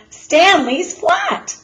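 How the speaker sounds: high, loud and slow exclamation; amazed boy. A narrator's reading. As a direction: stretch it out in amazement. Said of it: "said Arthur".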